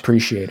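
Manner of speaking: "Appreciate" tried with an SH sound, pre-shee-ate; repeating with SH. Shown as a demonstration. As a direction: echo "Appreciate" with SH. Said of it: "like it's S H".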